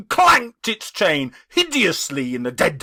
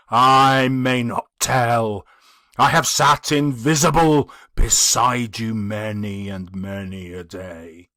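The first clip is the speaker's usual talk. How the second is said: solemn ghost; 3 semitones lower, 4 dB louder than usual. Acted. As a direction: weigh each word like a chain. The ghost speaks.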